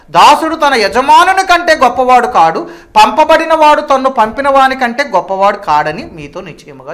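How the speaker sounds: loud, high preacher's quoting voice; scripture declamation. Acted. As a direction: proclaim the verse at full force. (బిగ్గరగా వాక్య ప్రకటన)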